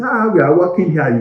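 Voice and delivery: loud, high-pitched objection; mimicked protest. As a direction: protest indignantly as the staff would. Imitating protesting staff members.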